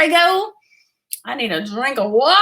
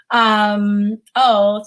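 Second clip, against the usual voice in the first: ordinary talk, nothing marked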